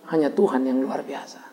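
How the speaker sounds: reverent whisper; hushed reverent whisper. Acted. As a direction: breathe it out in awe. Reverent closing line.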